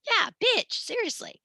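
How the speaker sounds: high, squealing mock "bitch, seriously"; sassy retort. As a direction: squeal it like an outraged audience member. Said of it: "you're all looking at me go".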